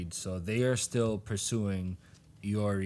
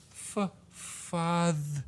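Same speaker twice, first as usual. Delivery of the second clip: high, slow, stuttered mimicry of a daughter; mocking; 9 semitones higher, much slower than usual. Hirohiko's head imitating Iori, mocking. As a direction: drag it out in a put-on mocking voice. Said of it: "He's mocking Iori".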